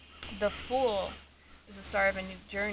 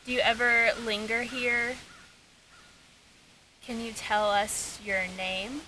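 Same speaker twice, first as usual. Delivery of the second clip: talking to a ghost; 3 semitones higher than usual. Real, not acted. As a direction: ask the ghost gently. EVP session questions.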